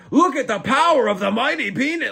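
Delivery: grand mock announcer, pitched high; mock commentator. Imagined commentator announcing a fighter, hammed-up. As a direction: boom it like a hammy fight caller. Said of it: "If I have to be like".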